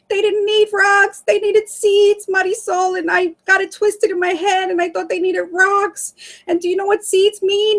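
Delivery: high, urgent, tearless mock hysteria; imitating hysterical sister. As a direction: pour it out high-pitched like a frantic sister. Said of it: "crying hysterical".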